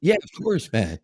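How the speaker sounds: high, eager party student; Canadian accent. Retold or imitated. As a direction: cheerfully agree like a Canadian kid.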